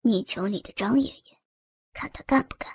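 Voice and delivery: low, hushed mocking voice; an older man taunts the girl, low and quiet. A narrator's reading. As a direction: taunt her softly from low in the throat. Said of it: "对陈燕说道".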